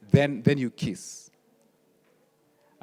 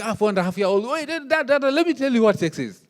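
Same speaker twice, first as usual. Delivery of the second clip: put-on eager child; 10 semitones higher, 6 dB louder than usual. Younger child eagerly interrupting dad.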